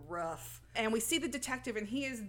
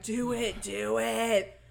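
demon voice; 6 dB louder, much slower than usual. Retold or imitated. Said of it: "that deep demon voice is like".

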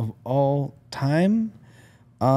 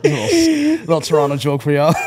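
real laughter breaking through; laughing it out at the end; 4 semitones higher, 7 dB louder than usual. Real, not acted. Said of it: "That was doing numbers".

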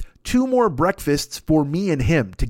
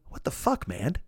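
unvoiced whispered aside; 6 semitones lower, 11 dB quieter than usual. Whispering to his friend Ahmad about the waiter. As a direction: hiss it so the waiter won't hear. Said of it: "I look at Ahmad and I go".

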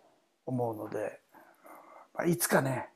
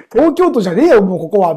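raised, loud mock complaint; mock angry protest; 5 semitones higher, 11 dB louder than usual. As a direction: grumble it louder like a fed-up local. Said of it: "みたいな". (冗談めかして怒る声)

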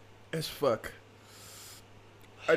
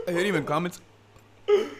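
hiccuping; 4 semitones higher, 4 dB louder than usual. Real, not acted. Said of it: "'cause I'm just fucking hiccuping".

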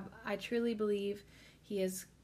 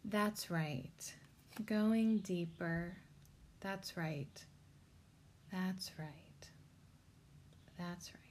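slow, fading, softer and softer until nearly whispered; hypnotic trance voice; 4 dB quieter, slower than usual. Shown as a demonstration. Demoing hypnotic induction for viewers, fading to a whisper. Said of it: "Say it slowly and with a downward intonation".

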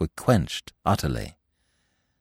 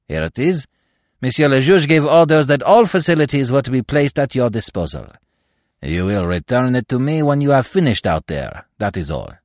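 official's voice; 4 semitones higher, 4 dB louder than usual. A narrator's reading. Attendant tells the visitor where Hautain is, politely. Marchot hands over the key.

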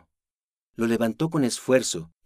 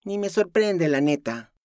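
defiant; 8 semitones higher than usual. Acted.

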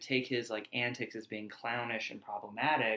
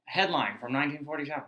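fast, brisk newsreel headline read; old-timey newspaper voice; 3 semitones higher, faster than usual. Pretend. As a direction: rattle it off like a 1940s newsreel. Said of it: "old-timey newspaper voices".

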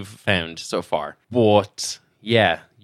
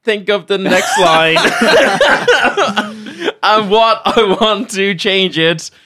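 high and loud through laughter; giggling at own flop; laughing; 9 semitones higher, 6 dB louder, slower than usual. Real, not acted. Musical improv, laughing.

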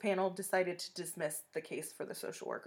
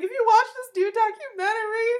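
high, loud mock sobbing; imitated sobbing; 9 dB louder than usual. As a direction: wail it out like a sobbing mom. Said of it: "she is just sobbing".

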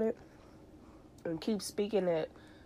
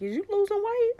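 high curious voice; 14 semitones higher than usual. Retold or imitated.

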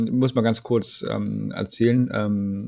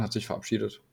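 fading to a soft mumble; trailing off; 2 semitones lower, 9 dB quieter, faster than usual. Real, not acted. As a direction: let the words die away. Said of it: "jetzt hab ich vergessen, was ich sagen wollte".

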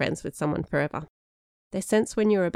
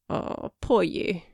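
slow, cheeky fake pity; mock sympathy; slower than usual. A narrator's reading. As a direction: tease with drawn-out false sympathy. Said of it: "sounding more in cheek than sympathetic".

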